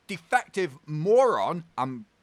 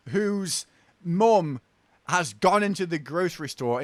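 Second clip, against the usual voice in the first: ordinary talk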